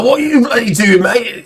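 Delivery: put-on Liverpudlian accent. Drunk local turns round to challenge photographer, in Scouse.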